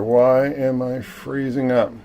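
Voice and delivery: ordinary delivery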